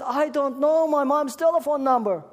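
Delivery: put-on high, loud lost-child voice; a little girl voice, lost and upset; crying little girl.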